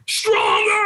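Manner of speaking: very high shouted Stronger; screamed. Retold or imitated. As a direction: scream it right in his face. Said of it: "got in my face and screamed at me".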